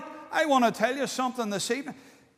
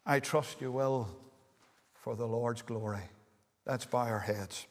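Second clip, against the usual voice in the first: soft closing appeal; 10 semitones lower, 11 dB quieter than usual. Real, not acted. Closing appeal before prayer.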